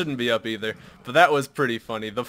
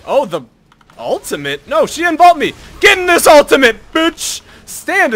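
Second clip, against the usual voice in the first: high, loud outraged shout; frustrated yell; 14 dB louder than usual. Real, not acted. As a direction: yell at the screen. Enemy skipped his trap, yelling.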